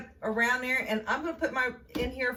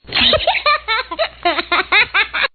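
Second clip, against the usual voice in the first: loud laugh; 11 semitones higher, 14 dB louder than usual. Real, not acted. Opens video laughing.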